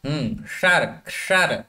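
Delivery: ordinary delivery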